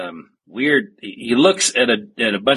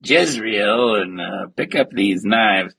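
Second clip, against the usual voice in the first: bad role-playing quest-giver; slower than usual. Priest handing out a quest, slow hammy role-play voice. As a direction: draw it out like a hammy game master. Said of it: "it is like a bad role-playing game".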